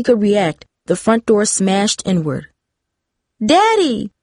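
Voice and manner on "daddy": yell. A narrator's reading. Daughter sees her father burst in, high yell. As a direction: yell out for Daddy, pitched well up. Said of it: "Darcy yelled".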